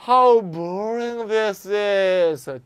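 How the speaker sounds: very slow, drawn-out fed-up groan of a line; bored annoyance. Shown as a demonstration. Teacher demonstrating boredom in an example, dragged out. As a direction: drag it out, utterly fed up. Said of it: "왕짜증이 요 감탄문 부호 속에 딱 배어 있죠".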